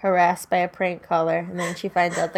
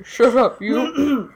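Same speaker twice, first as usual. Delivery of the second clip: playful monster growl; much slower than usual. Pretend. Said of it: "That's my monster voice".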